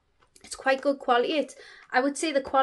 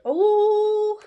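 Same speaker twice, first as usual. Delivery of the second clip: high, loud, held ooh; drawn-out high coo of excitement; excited "ooh"; 10 semitones higher, 5 dB louder than usual. Real, not acted. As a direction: let out a long high ooh of delight. Seeing the gold polish.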